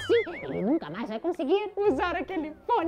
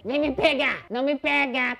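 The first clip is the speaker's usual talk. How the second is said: ordinary delivery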